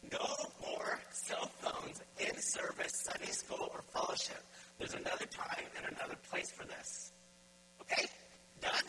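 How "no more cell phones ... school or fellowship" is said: slow, every word hammered home; emphatic. Real, not acted. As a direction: lay down the rule slowly and firmly. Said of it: "I'm nailing this on the head really hard, emphatically".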